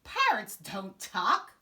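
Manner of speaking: loud, slow pirate refusal; pirate bellow. Acted. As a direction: bellow it flatly. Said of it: "he bellowed".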